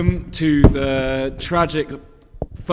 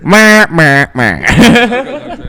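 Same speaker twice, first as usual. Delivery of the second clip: mocking blah blah; 9 dB louder, much slower than usual. Mocking someone's talk, loud.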